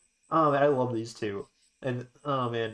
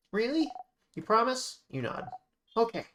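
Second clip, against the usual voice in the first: put-on high child voice; 7 semitones higher than usual. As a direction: pitch up, anxious little sister. Bonnie asking for reassurance.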